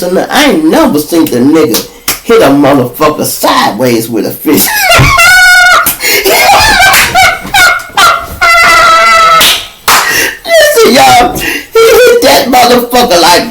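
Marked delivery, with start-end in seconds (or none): 4.7-10.4 loud high-pitched cackling laugh; shrieking laugh. Real, not acted. Big laugh at the story. 10.4-13.4 loud and high, still laughing; excited shouting. Real, not acted. Excited retelling through laughter.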